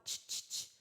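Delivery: Ch, ch, ch as the soft ch of tchau; teacher isolating the ch sound; unvoiced ch sounds. Shown as a demonstration. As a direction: hiss the ch sound softly without voice. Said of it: "com som de tchau".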